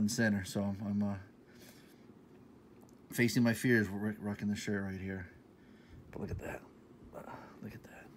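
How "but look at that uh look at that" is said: soft whispered look at that; hushed whisper. Real, not acted. Showing viewers the baseball tee, in a whisper. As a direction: breathe it out in awe.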